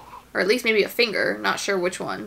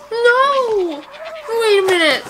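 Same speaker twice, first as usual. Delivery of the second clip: high, louder cry of alarm; shocked outcry; 13 semitones higher, 3 dB louder, slower than usual. Real, not acted. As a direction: cry out in sudden dismay. Shocked reaction to the film.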